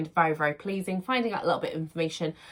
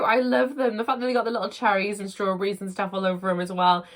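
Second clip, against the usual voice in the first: ordinary talk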